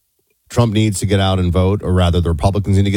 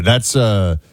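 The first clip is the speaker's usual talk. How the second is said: ordinary delivery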